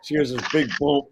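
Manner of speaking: ordinary delivery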